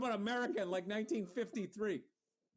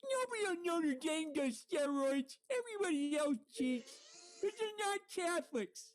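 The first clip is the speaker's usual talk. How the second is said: Lou Holtz impression; 7 semitones higher than usual. Doing Lou Holtz for his co-host, high coach voice. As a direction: preach faith to the team like an old-school coach. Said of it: "I think I went a little Lou Holtz".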